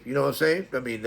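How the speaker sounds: usual speaking voice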